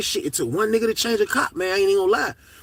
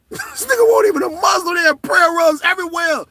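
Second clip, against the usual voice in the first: laughing disbelief; 11 semitones higher, 5 dB louder than usual. Real, not acted. Laughing at prayer rugs.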